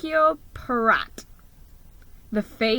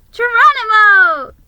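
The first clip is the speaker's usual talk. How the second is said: very high, loud squeak; squeaked name; 12 semitones higher, 13 dB louder than usual. Acted. Petunia greets Geronimo with a high, loud squeak. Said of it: "she squeaked".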